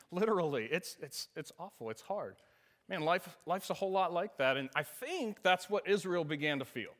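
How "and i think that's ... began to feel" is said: ordinary delivery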